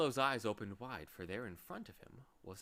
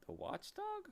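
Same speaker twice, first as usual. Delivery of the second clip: alarmed; 8 dB quieter than usual. Acted. Alarmed question.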